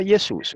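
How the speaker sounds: "Jesús" as ye-SUS, with a y sound; Greek pronunciation. Shown as a demonstration. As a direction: say "Jesús" with ou as one u. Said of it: "que se pronuncia Jesús".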